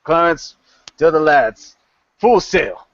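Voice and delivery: firm order in short phrases; captain giving orders. Acted. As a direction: send the word to the crew. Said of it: "And he says".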